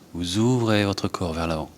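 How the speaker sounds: usual speaking voice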